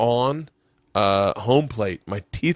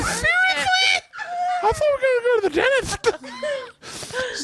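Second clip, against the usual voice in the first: high, laughing disbelief at his dad; hammed-up squeaky outrage, half laughing; whiny kid protest. Eleven-year-old begging dad not to play, high whine. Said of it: "was like".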